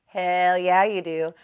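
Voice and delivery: loud hype; hyped cheer. Retold or imitated. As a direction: cheer him on like a hype friend. Cheering grandpa on.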